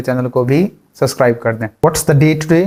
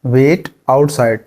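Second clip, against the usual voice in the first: each syllable stretched out; very slow; much slower than usual. Shown as a demonstration. Teacher drills the phrase slowly for learners. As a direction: say it very slowly and carefully. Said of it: "बाहर इंतजार करो".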